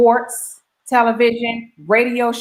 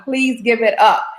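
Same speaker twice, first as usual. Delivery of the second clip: higher, drawn-out guest introduction; announcer's big introduction; 5 semitones higher than usual. Real, not acted. Host bringing on the judge, big drawn-out announcer call. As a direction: stretch out the name like an event host.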